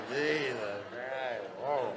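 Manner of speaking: stretched-out mock daze; drunken amazement. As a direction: reel at how drunk you are. Acting stunned at own drunkenness.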